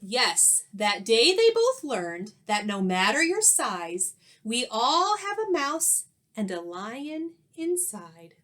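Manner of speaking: lively storybook read-aloud with rhyme; reading to children. A narrator's reading. Teacher reading a rhyming story to young pupils, animated. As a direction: read it like a picture book to the class.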